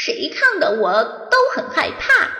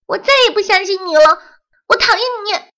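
furious; 13 semitones higher, 7 dB louder, much faster than usual. Acted. Furious final outburst.

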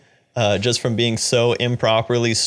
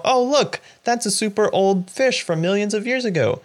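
much higher, breezy unbothered voice; mock casual pointing out; 9 semitones higher than usual. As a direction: play it like a character shrugging at a prehistoric fish. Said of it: "they're, like, pointing out".

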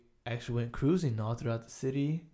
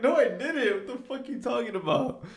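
high, protesting comeback; indignant denial; 10 semitones higher, 4 dB louder than usual. Retold or imitated. Him denying to his friend that he burnt the house, indignant. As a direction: act out a defensive retort, starting loud. Acted denial in pizza quarrel.

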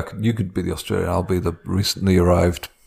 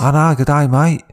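mock Aussie; 5 semitones higher than usual. Shown as a demonstration. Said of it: "I just go Cockney".

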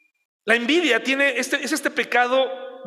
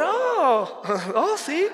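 coy laugh; 6 semitones higher, much slower than usual. Retold or imitated. Fishing for a compliment.

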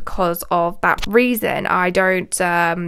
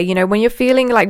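ordinary talk, nothing marked

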